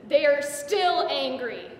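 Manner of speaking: loud, slow, insistent anger; fierce anger. Acted. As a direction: weigh down each word with fury. Speaks for her angry sisters.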